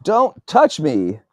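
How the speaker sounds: high, loud timid protest; forceful but fearful. A narrator's reading. Timid victim warning off his bullies, forceful yet full of fear. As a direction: push back loudly while still afraid. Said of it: "a bit more forceful".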